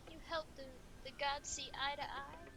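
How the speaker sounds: lightly theatrical lament; acted wistful mourning; grieving melodramatic admirer. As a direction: mourn a lost idol with florid longing. Plays mourner lamenting King in a game.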